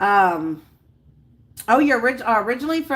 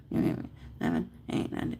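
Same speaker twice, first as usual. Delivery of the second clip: very soft, voiceless counting; counting to oneself; 12 dB quieter than usual. Real, not acted. Working out days until a birthday, whispered counting. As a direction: count under your breath.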